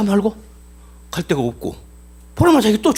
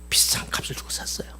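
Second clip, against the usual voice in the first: dramatic whisper; 10 dB quieter than usual. Real, not acted. Preacher stresses the high price.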